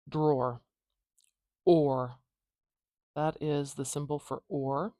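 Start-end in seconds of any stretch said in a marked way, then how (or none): 0.1-0.6 Drawer. as one syllable, with or at the end; model pronunciation. Shown as a demonstration. Teacher modelling the word before transcribing it. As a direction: clear single-syllable drawer. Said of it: "Watch what I do".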